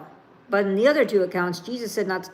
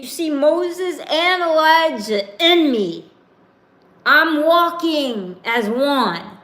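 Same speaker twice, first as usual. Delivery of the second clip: higher and louder exasperated protest; Jesus defending himself to doubters, higher and louder; getting angry; 5 semitones higher, 5 dB louder than usual. Acted. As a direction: vent as a fed-up Jesus. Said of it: "he's getting angry".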